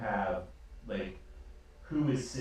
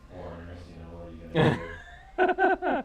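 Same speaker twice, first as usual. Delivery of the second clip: amused shrug; 9 semitones higher, 10 dB louder than usual. Acted. Twins laughing about foreigners, ending in a high squeal. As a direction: tease the foreigners.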